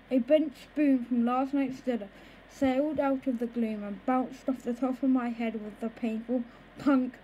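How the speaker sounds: ordinary delivery, nothing marked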